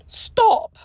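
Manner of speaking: very high, sharp cry to stop; anguished cry. A narrator's reading. Hermione silencing Draco, high anguished cry. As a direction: cry out to cut him off.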